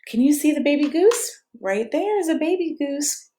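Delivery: plain reading voice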